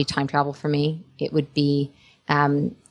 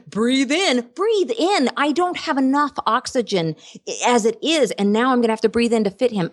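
high, squeezed-in protest; mock panic; 6 semitones higher than usual. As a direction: play-act straining to suck the belly in. Acting out squeeze on packed train.

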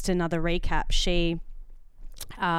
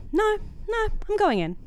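high-pitched, determined self-talk; resolute self-talk; 14 semitones higher than usual. Retold or imitated. Customer steeling herself to go back in, resolute.

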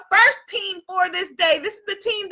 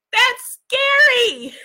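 mock scared; 8 semitones higher, 5 dB louder, much slower than usual. Playful fright at inner voice.

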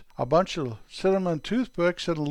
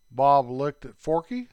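ordinary delivery, nothing marked

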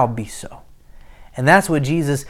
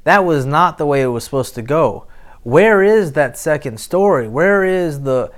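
ordinary talk

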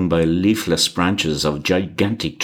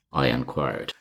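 plain reading voice

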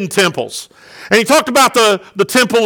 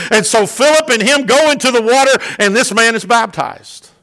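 ordinary delivery

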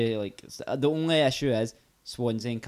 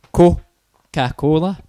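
"Coca-Cola" drawn out slowly in separate chunks; split into syllables; 9 dB louder, much slower than usual. Shown as a demonstration. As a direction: break "Coca-Cola" apart with gaps. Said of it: "You're just splitting it into syllables".

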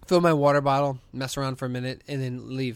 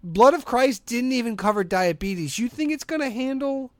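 exasperated; 8 semitones higher, 4 dB louder, slower than usual. Retold or imitated. Arguing with mom.

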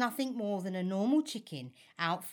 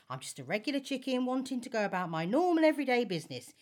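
ordinary talk, nothing marked

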